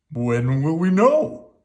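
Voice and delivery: Papa asking on the way home. A narrator's reading. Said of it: "asked Papa".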